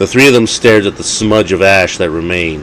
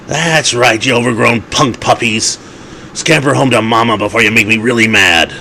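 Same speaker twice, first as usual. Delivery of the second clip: higher, mocking voice of a dapper vampire; taunting gentleman vampire; 4 semitones higher than usual. Vampire Paul jeering at fleeing wolves, mocking. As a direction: shoo off the wolves with lordly scorn.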